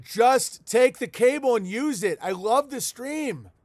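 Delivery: high, slow put-on voice, loud; hamming up an excited fan; mock Gary voice. Mocking Ethernet Gary's pleading, high and slow. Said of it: "But he's like".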